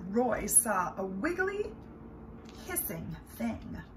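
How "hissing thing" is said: plain reading voice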